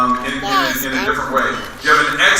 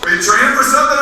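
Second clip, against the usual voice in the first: friendly coach John; 10 semitones higher, 4 dB louder, faster than usual. Retold or imitated. Swim coach John invites him.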